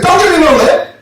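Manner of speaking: high-pitched angry warning; imitated irate owner; upset dog owner. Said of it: "He's upset with me".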